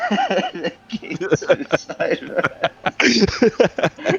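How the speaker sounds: slow, laughing punchline; giggling through it slowly. Real, not acted. Laughing at his own joke.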